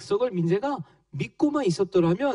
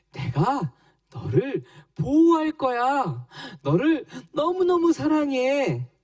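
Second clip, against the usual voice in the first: God's promise; 6 semitones higher than usual. Acted. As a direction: promise it like a loving father. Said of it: "라는 하나님께서 우리에게 약속을".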